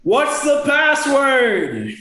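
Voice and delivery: high, drawn-out shouted demand; yelling creature. Acted. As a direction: yell it out as a little redcap, stretching it. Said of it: "one of them yells out".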